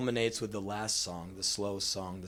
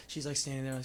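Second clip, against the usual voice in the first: usual speaking voice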